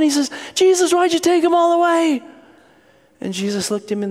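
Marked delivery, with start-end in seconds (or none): 0.5-2.2 high, hammed-up tearful whine; crying kid. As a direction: wail a child's complaint to Jesus. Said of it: "and he's crying".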